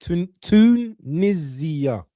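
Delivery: Tunisia drawn out slowly, syllable by syllable; slow careful pronunciation. Shown as a demonstration. Friend teaching the host to say Tunisia, slowly. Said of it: "You said it right".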